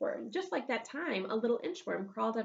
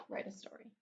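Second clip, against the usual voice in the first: whispered; 2 semitones lower, 11 dB quieter than usual. A narrator's reading. Story's sad admission.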